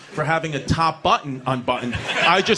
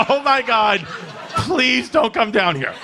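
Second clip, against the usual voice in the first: higher, louder mock-desperate plea; panicked pleading; 6 semitones higher, 4 dB louder, slower than usual. Son begs father to stay away from school, panicked. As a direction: beg him, slowing on the plea.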